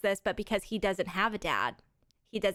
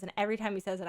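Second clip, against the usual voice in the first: ordinary delivery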